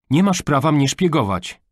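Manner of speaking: high, loud indignant accusation; outraged teenage objection; angry protest. A narrator's reading. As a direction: tell him off for snooping. Daughter accuses father of spying.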